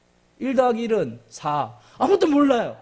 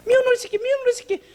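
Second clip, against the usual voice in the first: mocking taunt; 12 semitones higher, faster than usual. Others jeering at the ugly duckling, high mocking call. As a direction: sneer the name like a bully.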